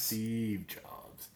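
breathy whispered echo of the pun; deadpan whispered repeat. Real, not acted. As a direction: whisper it back like a punchline.

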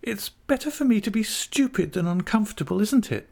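put-on high, girlish young wife seeking agreement; Dora. A narrator's reading. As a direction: appeal to him in a high child-wife voice. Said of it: "said Dora".

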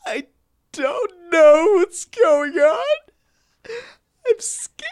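hammed-up tearful child, very high and slow; disturbed little child. Cohost imitating the actor playing a disturbed child, squeaky mock crying. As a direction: wail it like a scared little boy. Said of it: "plays a disturbed little child".

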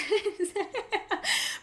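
a real laugh that shoots up in pitch; high burst of laughter. Real, not acted. Laughing at sexist coworkers.

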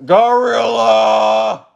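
high, long-held holler; playful shout. Pretend. Player choosing an animal as a Pokemon, shouting it. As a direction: draw it out like a summoning call.

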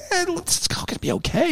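high, whimpering mock voice, falsetto squeak; playing himself nervous, high and whiny; mock whiny reassurance. Himself brushing off the finance guy, whiny high mock reassurance. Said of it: "and I'm going".

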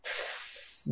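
"Tss" voiceless, a drawn-out hiss; just the hissing end of the word; isolated final sound. Shown as a demonstration. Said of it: "do final".

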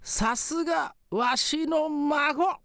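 put-on slow, high old ghost; quavering grateful spirit grandpa.

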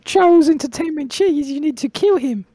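tiny high-pitched insect voice; high squeaky cricket voice. Acted. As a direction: squeak out the mission.

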